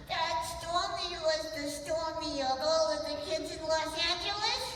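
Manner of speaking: put-on sing-song childlike puppet voice; Lamb Chop puppet voice. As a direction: lamb puppet building a case.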